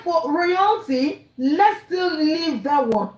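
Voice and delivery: slow, deliberate quoting of Baba; measured, slowed-down quote; slow quoted words. Retold or imitated. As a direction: spell out his words slowly, one by one. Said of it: "He said".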